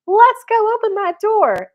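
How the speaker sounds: high, loud, gung-ho cry; hammed-up rallying call; mock eagerness. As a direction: imitate an overeager gentleman. Mocking imagined eager door-opening man.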